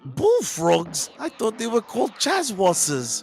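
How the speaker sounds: high, slow hammed-up Aussie accent; Simpsons Aussie accent. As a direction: mimic the Aussie from The Simpsons, drawn out. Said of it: "There's my Simpsons reference".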